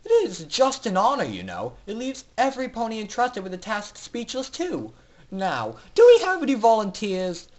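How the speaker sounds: high, loud mocking villain; gleeful announcer. Announcer mocks captive foals, asking for volunteers. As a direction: taunt them like a smug tyrant.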